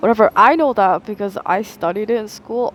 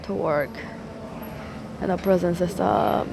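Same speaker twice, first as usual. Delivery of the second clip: mock Hunger Games volunteer; 5 dB quieter than usual. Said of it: "like the Hunger Games".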